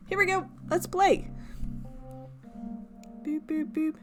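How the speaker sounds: ordinary talk, nothing marked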